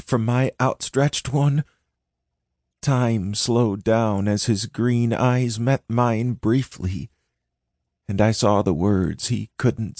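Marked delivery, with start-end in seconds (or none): none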